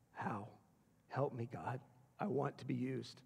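very soft, low plea to God; hushed, low, earnest petition; humble prayer. Acted. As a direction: pray it quietly, asking for help.

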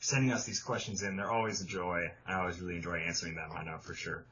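sincere or sarcastic, hard to tell; thanks trailing off low and soft; possibly sarcastic. Real, not acted. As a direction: sink into low, quiet, ambiguous gratitude. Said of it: "I can't tell if you're being sarcastic".

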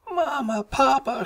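put-on very high frightened-child call; high-pitched scared wail of a little cub; crying out. A narrator's reading. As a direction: cry out for Mama and Papa. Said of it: "she cried".

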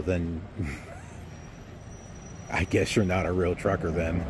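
normal talking voice

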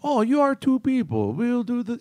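high, theatrical mad doctor voice; Hugo Strange. Retold or imitated. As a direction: play the gloating psychiatrist villain. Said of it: "The psychology. The, "You are t".

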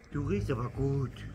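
usual speaking voice

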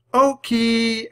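high, loud drawn-out name call; shouting. A narrator's reading. Annie chases the puppy toward the woods, shouting. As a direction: holler the dog's name. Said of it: "Annie shouted".

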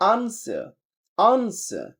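British "আনসার" with open AH and silent R, high; British accent. Shown as a demonstration. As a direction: drill "আনসার" British style, AHN-suh. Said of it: "ব্রিটিশরা বলতেছে আ". (British উচ্চারণে)

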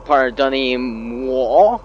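mock French. Mock French apology to game.

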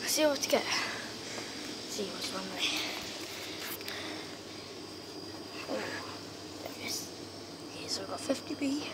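quiet hushed shop whisper. Real, not acted. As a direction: whisper so shoppers don't hear.

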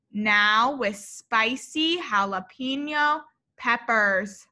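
usual speaking voice

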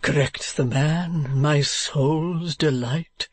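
slow, drawn-out endearment; Mr. Mantalini. Acted. Husband sweet-talking his wife, slow drawl. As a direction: draw it out as a doting husband.

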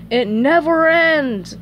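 loud, slow, high complaint; Squidward exasperated. Acted. Cashier rolling his eyes at his co-worker, drawn-out exasperated cry. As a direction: draw it out in exasperation.

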